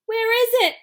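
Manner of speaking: high, loud shout. A narrator's reading. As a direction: call out over the crowd. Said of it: "someone shouted".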